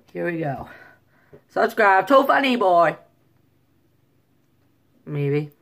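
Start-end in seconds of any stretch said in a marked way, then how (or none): 1.5-3.0 louder, higher imitation of a Chinese accent; mock Chinese accent. Shown as a demonstration. Vlogger running through accents for his plug, mock Chinese. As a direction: put on an emphatic Chinese accent.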